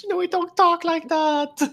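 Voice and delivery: put-on British accent. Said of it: "British comments that were like".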